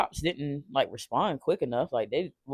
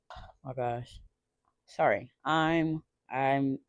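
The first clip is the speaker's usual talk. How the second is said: real upset, choked; 3 semitones lower, 7 dB quieter, slower than usual. Real, not acted. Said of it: "I get triggered thinking about this".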